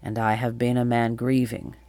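grieving king's subdued confession; low, remorseful. A narrator's reading. King tells Atalanta of searching for his lost child, sorrowful remorse. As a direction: let regret weigh down every phrase, pitched a little low. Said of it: "low voice, heavy with remorse".

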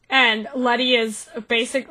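normal talking voice

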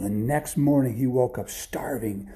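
ordinary delivery, nothing marked

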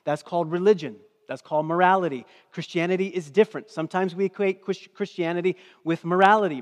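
loud, driving sermon delivery; preaching. Real, not acted. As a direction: preach it hard to the congregation. Preaching at full force.

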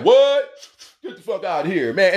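a sharp high loud What, then disbelieving; shocked disbelief. Retold or imitated. Friend hearing a crime story, shocked high and loud. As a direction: react like you can't believe it. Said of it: "You like".

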